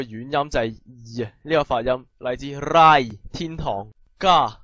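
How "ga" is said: normal talking voice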